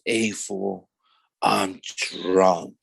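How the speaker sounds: imitating a defeated drunk brother, slow and low. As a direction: let the words sag, as if beaten. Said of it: "he was, like, defeated".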